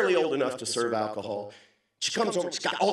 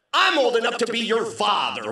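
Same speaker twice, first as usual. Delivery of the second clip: raised, loud mock outrage; indignant retort; 7 semitones higher, 5 dB louder than usual. Customer answering the cheeky young bartender, mock indignation. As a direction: protest it high and forceful.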